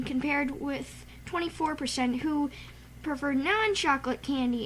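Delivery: ordinary delivery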